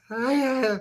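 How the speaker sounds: high-pitched mock nonsense syllables; squeaked-up imitation of gibberish; imitated babble. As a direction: mimic her garbled speaking in tongues. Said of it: "She was speaking in tongues".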